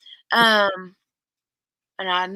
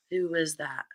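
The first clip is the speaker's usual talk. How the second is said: ordinary talk, nothing marked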